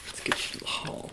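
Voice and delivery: soft whispered promise; tempting whisper. Acted.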